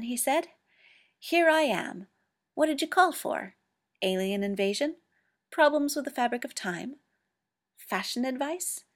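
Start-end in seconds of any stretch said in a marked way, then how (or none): 1.2-8.8 higher, louder, bouncing jokes; cheeky Doctor riffing at Mycroft; playful. A narrator's reading. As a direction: tease him like a whirlwind.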